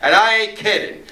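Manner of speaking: mock yell of a threat; retold scream. Drug dealer calling across the diner to Joey, mild mock scream. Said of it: "screams across the counter".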